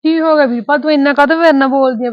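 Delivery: loud, high, hurt demand; angry sister-in-law confronting; upset confrontation. Acted. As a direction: confront her loudly. Acted quarrel between in-laws.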